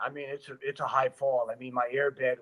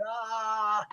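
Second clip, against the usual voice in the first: long high scream, held back in volume; mock scream all the way down; imitated falling scream; 9 semitones higher than usual. Stuntman retelling his jump off the ledge, mock screaming. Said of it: "I'm screaming".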